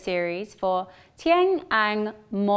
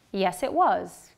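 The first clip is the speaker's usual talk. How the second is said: slow, strong "was" at the end; stressed was; 3 semitones lower than usual. Shown as a demonstration. Teacher models the short answer with strong "was". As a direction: stress "was" with a full vowel, falling. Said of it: "listen to the sentences twice".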